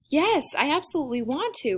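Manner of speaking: put-on high, eager girl's squeal; high-pitched, delighted child voice; excited squeal. A narrator's reading. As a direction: squeal your agreement like a thrilled kid. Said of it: "Rosa squeals from her seat".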